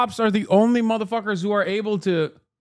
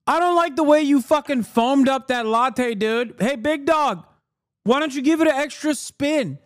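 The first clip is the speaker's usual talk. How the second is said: higher, snooty know-it-all customer voice; mocking onlooker; 7 semitones higher than usual. Streamer mocking a smug café onlooker, higher snooty voice. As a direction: sneer at the barista's latte like a smug bystander. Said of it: "as a random onlooker go".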